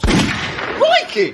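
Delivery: very high startled cry; alarmed yelp. Acted. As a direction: yelp it in sudden panic. Threatened while held up.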